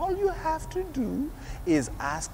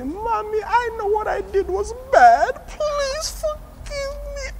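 put-on high, pleading little child's voice, much higher and louder; 8 dB louder than usual. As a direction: beg Mommy like a sorry little kid, slowing down on please forgive me. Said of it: "And you go and say".

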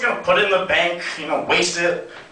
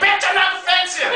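much higher, exploding comic outburst; pissed-off snap; 12 semitones higher than usual. Retold or imitated. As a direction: finally lose it and yell back. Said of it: "I was so pissed off".

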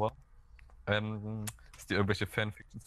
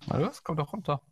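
taunting; 8 semitones higher than usual. Acted. Taunting opponent in game.

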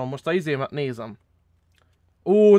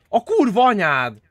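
loud, drawn-out amazed exclamation; amazed slow curse; 5 semitones higher, 5 dB louder, slower than usual. Real, not acted. Gamer amazed at friend's look, drawn-out loud curse. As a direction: stretch it out in disbelief. Friend shows off new skin.

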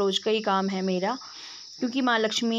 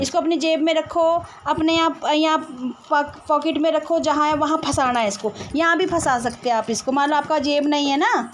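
loud and high so a deaf ear catches it; raised voice; 6 semitones higher, 5 dB louder than usual. Real, not acted. To the hard-of-hearing uncle, loud and high. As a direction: call out clearly to someone hard of hearing. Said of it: "ज़ोर ज़ोर से चिल्ला चिल्ला के बोलना पड़ता है".

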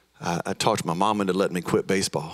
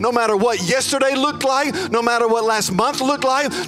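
higher, louder preaching with big swells; preacher at full force over music; 11 semitones higher, 4 dB louder than usual. Acted. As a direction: lift the room, pressing on. Preaching climax to the room.

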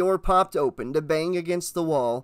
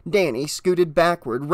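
plain reading voice

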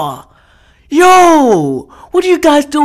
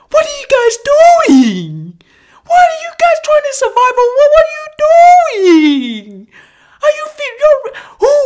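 very high-pitched, incredulous questioning; exasperated outcry; 13 semitones higher, faster than usual. Real, not acted. Viewer questioning anglers who fish among crocodiles, exasperated high outcry. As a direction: squeal the questions in real disbelief.

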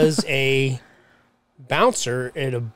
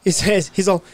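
usual speaking voice

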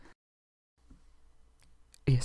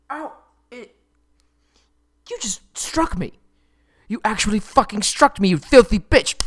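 high furious shriek growing louder; outraged shriek; 10 semitones higher, 5 dB louder than usual. Acted. Struck prince screaming at the captain in outrage. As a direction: shriek at her for hitting him.

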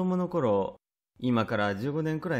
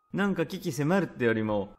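ordinary delivery, nothing marked

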